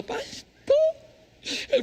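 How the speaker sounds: whiny wife. Retold or imitated. Crying wife quoted.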